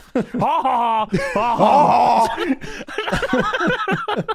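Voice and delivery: loud high "ha, ha, ha" guffaw; big fake belly laugh; crazy mock laugh. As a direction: imitate an overdone, inhuman laugh. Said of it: "this is a crazy laugh".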